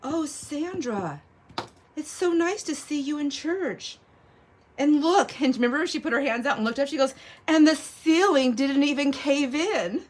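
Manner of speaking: high, slow, syrupy church lady; a hint of a sugary church lady. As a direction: sweetly needle her about church. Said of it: "came up to me and said".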